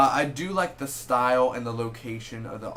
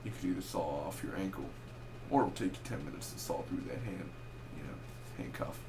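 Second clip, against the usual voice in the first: low, soft quoted voice; movie line quote; 5 semitones lower, 9 dB quieter than usual. Retold or imitated. Reviewer quoting the handcuff threat, low and soft without the accent. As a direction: drop low and quiet for the film line.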